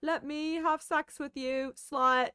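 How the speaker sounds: high, slow mock British boy-band accent; [name] accent. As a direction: camp it up in a fake British lilt. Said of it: "the [name] accent we were doing yesterday".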